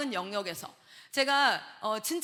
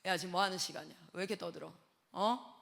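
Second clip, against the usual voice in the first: low, softer stern scolding; lowered stern voice; 7 semitones lower, 7 dB quieter than usual. Retold or imitated. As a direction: growl it low like a strict teacher. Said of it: "목소리 깔면". (아이들을 꾸짖는 낮게 깐 목소리)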